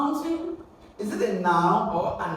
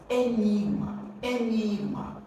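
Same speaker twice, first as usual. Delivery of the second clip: second syllable of "enigma" stressed, said twice; "enigma" repeated as a model word, weight in the middle; 3 semitones higher than usual. Shown as a demonstration. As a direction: land on the NIG in "enigma". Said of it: "having its stress on the second syllable".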